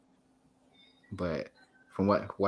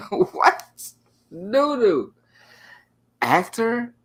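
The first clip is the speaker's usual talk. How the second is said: loud, higher, laughing disbelief; mock outrage; 11 semitones higher, 8 dB louder, much slower than usual. Streamer reacting to chat calling him an actor, mock outraged. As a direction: overplay the offence, giggling.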